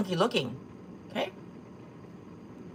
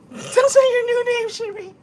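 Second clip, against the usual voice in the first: high, louder words through laughter; laughing; 6 dB louder than usual. Real, not acted. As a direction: cackle the line out. Laughing at her own joke.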